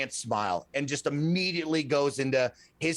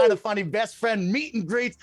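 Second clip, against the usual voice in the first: loud, higher show-host announcement; big announcer finish; hype host intro; 6 semitones higher, 4 dB louder than usual. Retold or imitated. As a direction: sell the event like a hype man. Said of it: "And I turn and I go".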